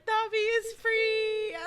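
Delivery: high, slow, drawn-out cheer; mock-triumphant cry. Fan imitating singer told he can go, mock-triumphant. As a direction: proclaim it high, stretching the last word.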